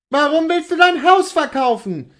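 high, loud alarmed wife; distraught cry. Acted. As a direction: cry out at your husband, aghast. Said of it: "rief sie, indem sie mit einer verstörten Gebärde".

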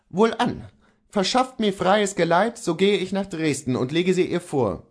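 a defiant man holds his ground; Kohlhaas voice. Acted.

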